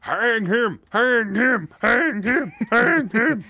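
high-pitched mock crowd chant; mob yelling. Co-host playing the crowd outside the courthouse, mock yelling. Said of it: "most of them yelling".

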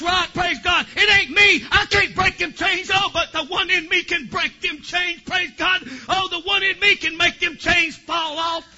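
loud, high, shouting deliverance preaching; full-force preaching. Acted. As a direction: cast the devil out at full voice.

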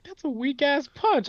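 high, slow disbelieving scoff; incredulous. Retold or imitated. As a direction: scoff at the punch. Quoting friend's reaction.

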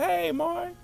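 put-on high girlish greeting; Felicia's voice. Felicia greeting Mari back, high and girlish. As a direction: answer him in a high little-girl voice.

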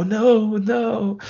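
high, drawn-out mock wail; hammed-up anguish. Hero given another excuse to overact, hammed-up anguish. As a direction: wail it like a bad actor.

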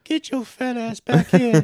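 put-on very high, slow creepy old man voice; Family Guy old man voice. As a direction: play the creepy old neighbor, high and drawn out. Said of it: "That does kinda sound like him".

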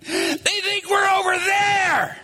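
high, drawn-out laughing taunt; gleeful fish. Acted. Hidden fish laughing at the fishermen, gleeful. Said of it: "fish under there going".

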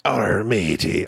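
slow, low drawn-out arr matey; low slow pirate mock. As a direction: ham up the pirate growl. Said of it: "Irish pirate".

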